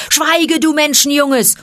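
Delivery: high, loud heckle from the crowd; wild shouting. A narrator's reading. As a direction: shout him down. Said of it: "Wildes Geschrei erhob sich". (wild dazwischengeschrien)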